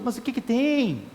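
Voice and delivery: hushed slow rhetorical question; soft, slow challenge. Acted. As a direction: quietly ask "Mas o que que tem?" slowly. Repeated challenge, soft first.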